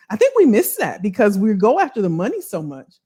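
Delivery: ordinary talk, nothing marked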